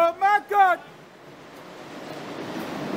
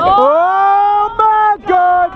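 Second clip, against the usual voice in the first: long loud ride scream; on a roller coaster; 5 semitones higher, 6 dB louder, much slower than usual. Real, not acted. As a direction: yell it slow as the ride drops. Ride drops.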